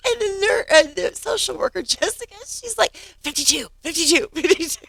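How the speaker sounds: high, giggly retelling, breaking into laughter; real laughter bubbling through the words; laughing while telling. Real, not acted. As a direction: laugh your way through the story. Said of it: "And I was just laughing".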